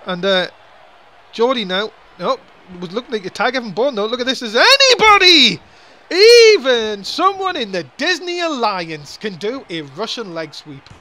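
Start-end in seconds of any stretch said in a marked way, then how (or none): 4.4-10.9 catchphrase yell. Real, not acted. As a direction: belt out the catchphrase. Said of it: "you gotta stick to your catchphrase".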